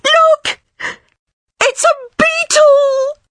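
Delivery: piercing high shriek, last word stretched; terrified scream. A narrator's reading. Friend seeing a beetle on the floor, screaming. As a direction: scream it out in panic. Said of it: "she screamed".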